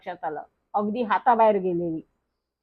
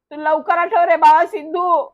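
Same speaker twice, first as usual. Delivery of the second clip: higher, louder storybook shout; pleading yell; 5 semitones higher, 6 dB louder than usual. A narrator's reading. Stuck rider begs his friend to remember, yelling. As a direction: call out urgently to a forgetful friend. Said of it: "मी परत ओरडलो".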